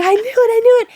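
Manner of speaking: high, loud squeal of joy; ecstatic cheering. Retold or imitated. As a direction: shriek it like you just won. Repeats the joyful cheer.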